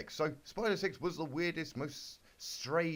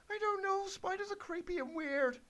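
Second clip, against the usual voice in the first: put-on much higher, squeaky girlish falsetto; squeaky Twilight voice. Twilight answers Big Mac in a high squeaky falsetto. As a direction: pitch way up into a silly cartoon squeak.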